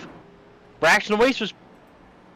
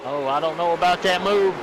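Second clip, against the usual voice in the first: normal talking voice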